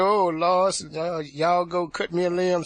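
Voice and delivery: loud, high appeal to the Lord; mock alarmed auntie. Religious aunt summoning family for switch, loud mock alarm. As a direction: imitate the aunt calling out orders in alarm.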